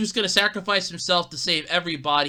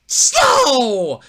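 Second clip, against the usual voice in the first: loud exclaim; 6 semitones higher, 9 dB louder than usual. Retold or imitated. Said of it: "I'm like".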